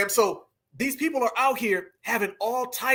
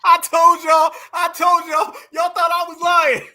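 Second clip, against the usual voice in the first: high, loud told-you-so shout; laughing I-told-you-so yell; gleeful shout; 8 semitones higher, 8 dB louder than usual. Real, not acted. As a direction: crow it, loud and vindicated. Vindicated, laughing at the clip.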